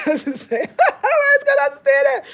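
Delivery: laughing through the words, rising high and loud. Real, not acted. Joking about beating kids, laughing hard. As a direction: crack up while talking. Laughing at her own joke.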